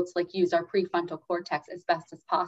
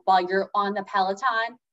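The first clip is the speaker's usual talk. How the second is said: usual speaking voice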